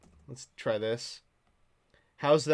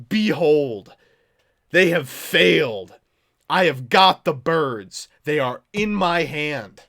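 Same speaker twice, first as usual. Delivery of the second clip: loud, slow, grandiose announcement; triumphant proclamation; 11 dB louder, slower than usual. Pretend. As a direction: proclaim victory over the plotters, slowly. Mock-triumphant reveal of the cards.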